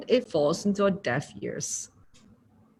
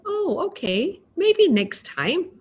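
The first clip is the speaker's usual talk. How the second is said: higher, slow, easygoing reply; gentle acceptance; 9 semitones higher, slower than usual. Shown as a demonstration. Teacher showing a kind reply to a no, high and slow. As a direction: let the refusal go lightly. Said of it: "So speak gently".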